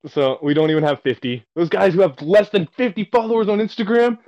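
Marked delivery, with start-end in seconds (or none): 1.6-4.2 high, loud, slowing mock rant; building mock fury, much higher and louder; mock angry guy. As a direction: ham up the furious customer.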